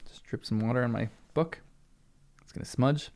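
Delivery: breathy whispered aside. Real, not acted. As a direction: whisper it under your breath. Aside about dripping water.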